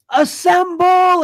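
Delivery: high, loud, drawn-out battle cry; Captain America yell. Retold or imitated. Host imitating Captain America's ultimate for co-hosts, yelled. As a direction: imitate a hero's rallying yell, stretched long. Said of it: "he yells".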